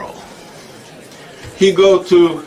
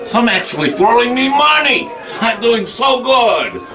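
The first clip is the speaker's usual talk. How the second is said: excited; 7 semitones higher than usual. Acted. Excitedly recounts table dancing.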